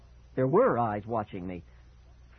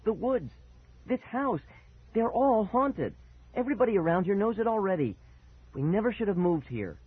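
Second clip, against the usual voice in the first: desperate; 8 semitones higher than usual. Acted. Boy desperately convincing father.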